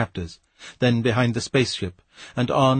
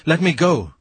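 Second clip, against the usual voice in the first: raised, higher-pitched cry to be released; a contained outcry, pitched up in alarm; 5 semitones higher, 4 dB louder than usual. A narrator's reading. Frightened captive to the man gripping him, crying out. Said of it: "he cried".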